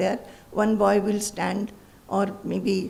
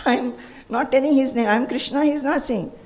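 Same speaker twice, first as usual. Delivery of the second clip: normal talking voice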